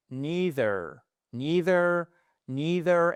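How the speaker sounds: NEITHER as NEE-ther, drawn-out ee, voiced th; alternative ee pronunciation. Shown as a demonstration. English teacher drills the other option, careful ee-vowel variant. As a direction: model NEITHER carefully with a long ee. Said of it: "qu'on peut aussi prononcer".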